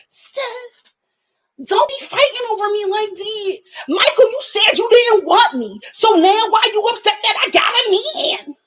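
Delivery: high, mocking woman's voice; mocking Sarah. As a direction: ham up a woman scolding her ex. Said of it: "mocking Sarah again".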